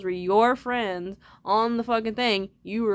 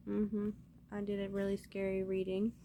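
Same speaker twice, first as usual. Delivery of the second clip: ordinary delivery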